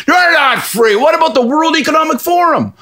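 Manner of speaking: high mock voice pressing the point; insistent conspiracy drunk. Drunk guy insists Scott isn't free, high mock insistence. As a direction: hammer him with the conspiracy.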